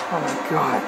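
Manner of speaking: very low, barely voiced disbelief; stunned hush. Real, not acted. Commentator stunned by the betrayal, hushed disbelief. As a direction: let the shock drain your voice. Stunned after steel chair attack.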